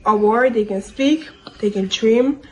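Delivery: ordinary talk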